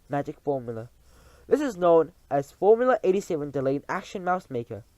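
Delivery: witch queen unveiling her formula. Acted. Grand High Witch speech.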